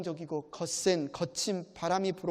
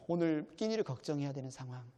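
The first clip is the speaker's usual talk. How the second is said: ordinary talk, nothing marked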